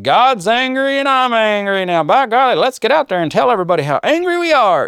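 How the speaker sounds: high, loud, mocking zealot voice; hammed-up fired-up believer itching for a fight; mock hothead. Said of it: "Some of you are immediately gonna jump to".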